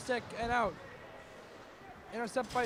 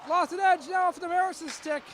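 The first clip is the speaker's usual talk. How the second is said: quick burst higher and louder; excited call; 7 semitones higher, 6 dB louder than usual. Real, not acted. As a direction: jump up on the near miss. Sudden chance, excited call.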